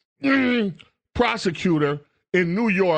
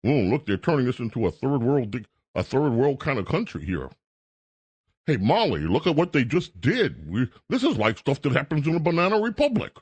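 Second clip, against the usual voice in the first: mocking timid Republicans; 4 semitones lower, faster than usual. Host mocking Republicans on the sidelines, lower mock fretting. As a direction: play a hand-wringing bystander clutching pearls. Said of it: "Republicans sit in the sidelines".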